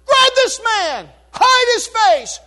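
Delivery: enraged, slow, loud command; furious king. Retold or imitated. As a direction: seize him in a royal rage. King orders Haman seized.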